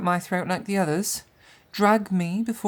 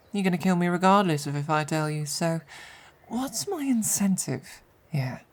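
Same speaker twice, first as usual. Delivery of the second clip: usual speaking voice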